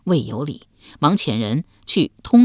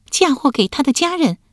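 put-on young woman voice; 9 semitones higher than usual. A narrator's reading. Wife's voice.

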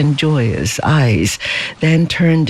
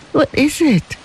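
quavering; 11 semitones higher than usual. A narrator's reading. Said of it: "quavered Lei Chu".

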